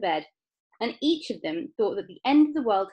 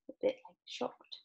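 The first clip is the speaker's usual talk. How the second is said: whispered; 9 dB quieter than usual. Real, not acted. Child answering question softly.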